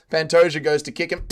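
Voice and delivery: usual speaking voice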